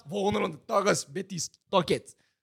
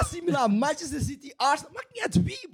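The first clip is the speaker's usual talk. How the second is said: excited boasting; 7 semitones higher than usual. Real, not acted. Boasting, pitch climbing high.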